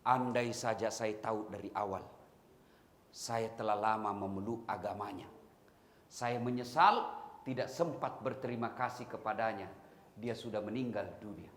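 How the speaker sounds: low, soft, sorrowful confession; tearful regret. Retold or imitated. Blind man learning of the Prophet's death, softly tearful. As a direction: speak it softly through regret. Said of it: "Menetes air matanya".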